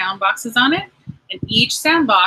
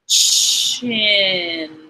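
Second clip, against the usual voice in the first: "Chin" stretched out slowly. Shown as a demonstration. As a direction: draw out "Chin" for the child. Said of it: "Say it slowly".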